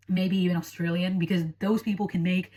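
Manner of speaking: ordinary talk, nothing marked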